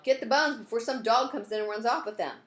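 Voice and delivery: high, loud urgent call; a woman yelling at her helper to grab the bones. Acted. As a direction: shout it to get the bones moving. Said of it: "Janet yelled".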